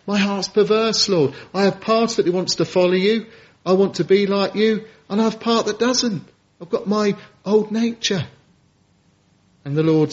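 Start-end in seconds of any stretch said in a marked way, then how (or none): 0.1-8.2 pleading preacher. Acted. Voices the struggling believer.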